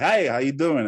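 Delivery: punched-up presenter hello; on-air personality. Shown as a demonstration. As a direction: greet them like a TV personality, big and bright on "Hey". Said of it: "personality, so I should be like".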